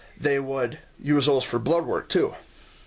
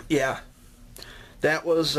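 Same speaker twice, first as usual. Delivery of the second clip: normal talking voice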